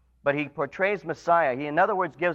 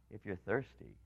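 much softer, lower and hushed; soft invitation; 8 semitones lower, 16 dB quieter than usual. Acted. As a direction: draw the listener in gently. Soft invitation after the call.

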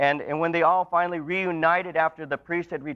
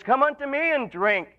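high, ringing call; crying out; 8 semitones higher than usual. Retold or imitated. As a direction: call them to come and drink. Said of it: "he cries out".

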